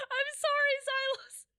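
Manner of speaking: high, loud fake-crying sorry; hammed-up tearful apology to a viewer; mock whimpering apology. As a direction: whine it like a wounded child.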